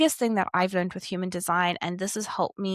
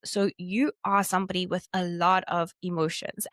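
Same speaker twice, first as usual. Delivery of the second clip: ordinary talk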